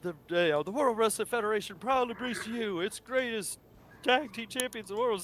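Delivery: lightly voiced ring announcer intro; half-hearted ringmaster call to the crowd. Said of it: "that's how it goes".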